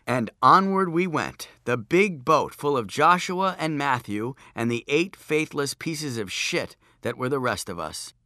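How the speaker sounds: usual speaking voice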